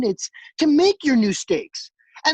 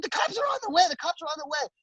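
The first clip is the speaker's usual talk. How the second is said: high-pitched imitation of a worked-up woman; mock frantic announcement; 10 semitones higher, faster than usual. Coworker announcing police are coming, mock frantic cry. Voicing Pina calling out from back.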